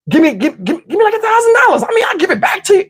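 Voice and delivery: high, pleading mimic of her; stammering girlfriend. Imitating girlfriend begging him for money, high and fast. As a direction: squeak out her stuttering plea.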